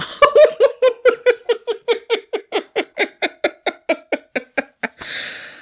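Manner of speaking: very high, long peal of laughter; real laughter. Real, not acted. After quoting Hell no, long high laughter. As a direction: burst into helpless laughter.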